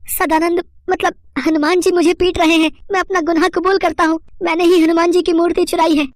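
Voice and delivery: very high, frightened cry for help; panicked confession. Acted. Beaten thief confessing to the inspector, high-pitched panic. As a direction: blurt it out in a high, terrified voice. Thief flees to police for rescue.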